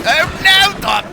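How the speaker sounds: very high, loud wail of dismay; theatrical shocked exclamation; horrified outcry. Acted. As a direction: cry out aghast at an accident. Sees horse hit by tram.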